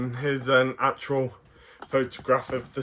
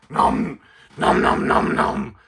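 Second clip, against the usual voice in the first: munching creature voice; 3 dB louder, slower than usual. Acted. Puppet Stripe munching.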